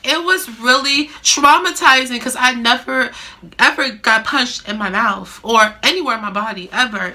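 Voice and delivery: ordinary delivery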